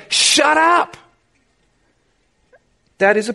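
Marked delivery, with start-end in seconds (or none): 0.1-1.0 high, sharp mock shout from the crowd. Crowd telling the blind beggar to hush, high mock shout. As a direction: snap it like an annoyed bystander, hammed up. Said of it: "people are saying to him".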